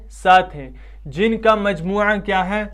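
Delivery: ordinary talk, nothing marked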